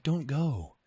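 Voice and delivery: low, slow seductive plea; mock-sultry voice of a possessive place; seductive Montana. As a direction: draw it out like a clingy lover. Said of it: "Montana was like".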